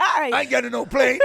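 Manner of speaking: high, loud Scouse whine through laughter; mock Scouse kid. Host mocks kids refusing a plane, Scouse accent. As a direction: play a stroppy Liverpool kid refusing. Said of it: "It's your kids in a Scouse accent".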